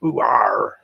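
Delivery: a lightly done rural "Ooh, arr."; a hint of a yokel growl; West Country farmer. Shown as a demonstration. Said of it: "That's a farmer".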